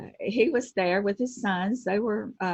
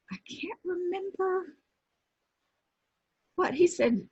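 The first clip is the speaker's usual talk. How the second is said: whispered aside; 12 semitones higher than usual. Real, not acted. Aside while trying to recall.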